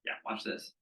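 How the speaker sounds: hushed and unvoiced, sly; whispered promise to fix it; conspiratorial whisper. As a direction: lean in and whisper it like a secret trick. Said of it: "I go".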